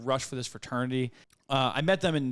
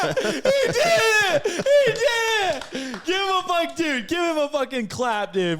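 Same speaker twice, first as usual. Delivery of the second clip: much higher and louder whooping cheer; wild celebration; 6 dB louder, slower than usual. Real, not acted. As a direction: celebrate like the team just scored. Celebrating the joke landing.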